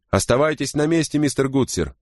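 high-pitched call across a distance. A narrator's reading. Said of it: "крикнул Кауч".

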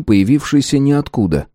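plain reading voice

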